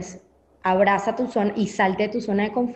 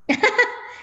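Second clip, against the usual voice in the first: short laugh; 13 semitones higher than usual. Real, not acted. Laughs after pandemic punchline.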